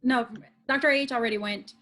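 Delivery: ordinary delivery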